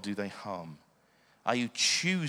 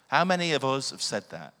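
usual speaking voice

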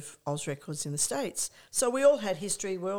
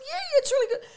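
very high, loud gushing; squealed mock enthusiasm; enthusiastic agreement; 7 dB louder, faster than usual. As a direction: act out eagerly praising a friend. Acting out delighted exchange.

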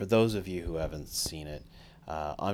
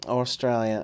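put-on loud, higher, would-be Australian accent; dubious Australian accent; 5 semitones higher, 7 dB louder than usual. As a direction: have a silly go at an Australian accent. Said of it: "I don't know what this is".

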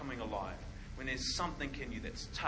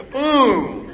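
comic boom; 11 semitones higher, 16 dB louder than usual. Pretend. Knockout punchline sound.

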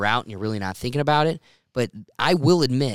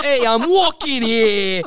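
much higher, louder mock New York shout; mock New York accent; 11 semitones higher, 9 dB louder, slower than usual. Host mimics foreigners doing a New York accent, loud. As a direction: bark it like a cabbie in New York. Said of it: "they're like".

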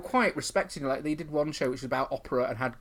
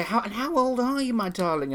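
higher, doting host voice; mock sweet host; 10 semitones higher than usual. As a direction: coo like a kindly compère. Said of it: "also you say".

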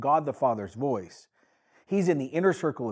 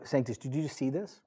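normal talking voice

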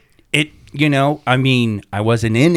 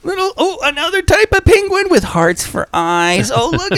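very high-pitched cooing over something cute; gushing. Real, not acted. Host admiring adorable penguin cookies, high gushing coo.

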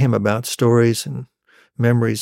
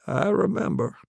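softer, higher voice for Dad; dad's quiet reply; 7 semitones higher, 6 dB quieter than usual. Acted. Dad admitting he remembers the story, quiet reply. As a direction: answer softly in a raised pitch. Dad answers his child.

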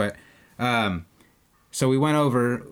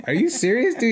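higher and louder, incredulous; surprised disbelief; 13 semitones higher, 5 dB louder than usual. Retold or imitated. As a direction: blurt it out in happy disbelief. Fan recognizes him, shocked reply.

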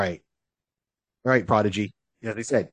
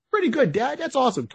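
put-on high-pitched eager kid voice; hunter's young son; 12 semitones higher than usual. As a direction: chirp it like a boy to his dad. Voices the son to his dad.

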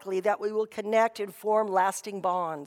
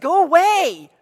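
retold brave shout, higher and much louder; bravest voice; 6 semitones higher, 11 dB louder than usual. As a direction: call out loudly, putting on courage. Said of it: "with her bravest voice".

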